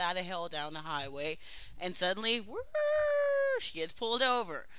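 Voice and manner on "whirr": high, loud siren wail; imitated siren. Joke teller as the police car pulling her over, imitated siren.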